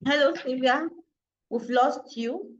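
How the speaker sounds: ordinary talk, nothing marked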